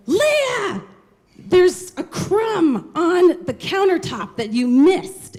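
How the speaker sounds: high, slow, nagging shout; grandma screaming. Retold or imitated. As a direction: scream about a tiny crumb. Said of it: "communicated was through screaming".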